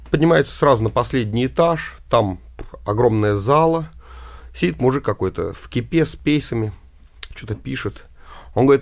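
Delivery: ordinary talk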